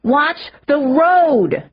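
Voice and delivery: high, slow, loud yell; shouted. A narrator's reading. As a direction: bellow a warning. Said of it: "Connor and I shouted".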